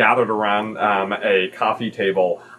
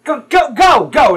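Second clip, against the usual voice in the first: put-on high, loud, urgent child voice; bossy kid urging; 7 dB louder than usual. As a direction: send another child off in a hurry. Sprat orders Kelsis.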